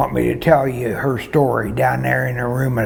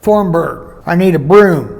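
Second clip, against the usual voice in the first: yelling; 5 semitones higher, 7 dB louder than usual. Retold or imitated. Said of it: "Ida yells at her".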